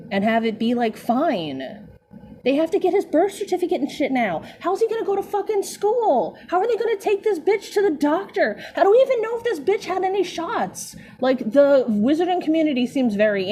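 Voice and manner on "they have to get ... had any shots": incredulous rant. Real, not acted. Ranting about baby's paperwork.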